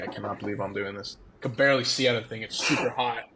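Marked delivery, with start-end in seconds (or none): 0.0-1.2 disbelieving mutter. Retold or imitated. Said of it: "I'm like".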